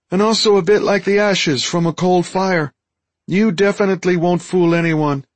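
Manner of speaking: high, loud, squeaky alien voice; high-voiced Deltan, frank. Deltan tells friend he smells wrong, high frank creature voice. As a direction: squeak out an apologetic but blunt verdict.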